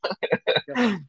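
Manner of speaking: high giggle; short high-pitched chuckling burst; laugh. Real, not acted. Host tickled by own circus pitch, high giggle.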